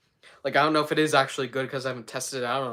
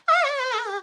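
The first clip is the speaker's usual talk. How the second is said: very high held "ah"; sudden high sustained wail; high cartoon cry; 4 dB louder than usual. Streamer starting an impression, high cartoon cry. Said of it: "Also, I can do a really good".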